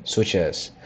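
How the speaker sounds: ordinary delivery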